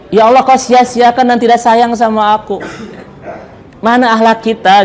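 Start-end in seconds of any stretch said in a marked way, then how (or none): 0.1-2.6 whiny put-on voice with a laugh; mock whining complaint. Preacher mocking a believer who whines to Allah. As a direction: pout it out, half laughing.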